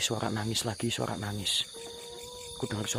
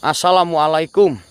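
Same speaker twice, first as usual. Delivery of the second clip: calling out to spirits; 6 semitones higher, 12 dB louder than usual. Real, not acted. Greeting the spirits.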